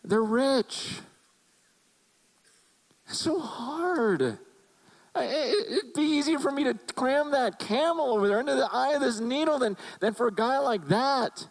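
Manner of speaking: Jesus grieving as the rich ruler leaves; Jesus lamenting. Retold or imitated. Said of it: "He goes".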